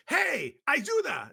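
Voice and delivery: high, loud shout in Spanish; urgent loud holler; yelled call for help. Acted. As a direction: yell out for help, drawing out the words. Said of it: "he just kind of yells".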